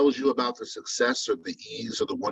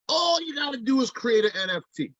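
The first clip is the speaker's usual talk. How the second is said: mock naive newcomer; 13 semitones higher than usual. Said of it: "they think".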